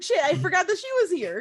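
high, loud burst of alarm; panicked. Acted. Player voices her character remembering the baddie, panicked. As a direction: blurt it out in a sudden panic. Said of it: "like, in a panicked voice".

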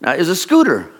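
ordinary delivery

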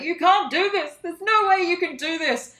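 high, loud protest; yelling. Acted. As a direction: yell it up a mine shaft. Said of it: "Stoat is yelling up the mine shaft".

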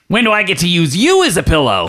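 high, eager put-on voice; lightly voiced eager companion; character voice. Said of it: "he goes".

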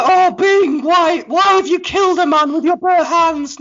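high-pitched mock dismay; imitated Flop. Guardian confronting Bing over an absurd killing, high mock dismay. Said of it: "Flop's just like".

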